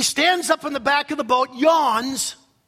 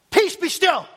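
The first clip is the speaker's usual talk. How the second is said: high, forceful order to the storm; divine command; 7 semitones higher, 4 dB louder than usual. Retold or imitated. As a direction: command it with raised voice, as God. Voicing God commanding the storm.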